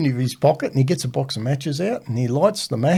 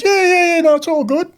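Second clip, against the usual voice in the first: put-on pitched up high and breezy, a cheeky teen; mimicking an overeager kid; 4 dB louder than usual. Kid tells the worried teacher he's fine, high and breezy. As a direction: play the carefree kid shrugging off danger.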